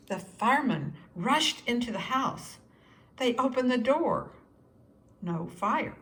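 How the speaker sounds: usual speaking voice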